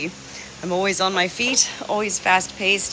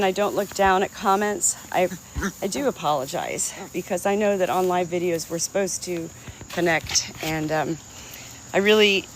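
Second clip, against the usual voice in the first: usual speaking voice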